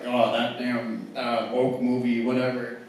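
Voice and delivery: low, slow grumble, lightly mocking; mock disgruntled customer. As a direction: imitate an annoyed moviegoer grumbling. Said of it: "be mad at me".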